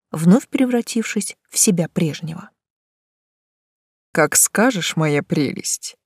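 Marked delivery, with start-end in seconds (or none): 4.1-6.0 tender. A narrator's reading. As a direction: coo it lovingly. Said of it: "с нежностью произнёс он".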